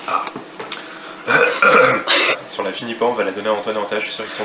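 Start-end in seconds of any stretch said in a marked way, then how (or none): 0.3-2.4 coughing. Real, not acted. Coughing after undiluted syrup.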